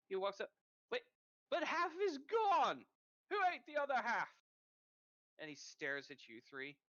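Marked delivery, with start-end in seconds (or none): 0.9-4.3 outraged man. Acted. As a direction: bellow in outrage that his food is half gone. Man finds half the food gone.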